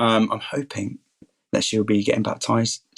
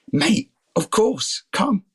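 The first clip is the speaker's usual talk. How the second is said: high, slow, delighted invite; eager welcome; 11 semitones higher, much slower than usual. Retold or imitated. To the care home resident asking about church, an eager high welcome. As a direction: stretch it out gladly, pitch climbing. Warmly inviting the old man.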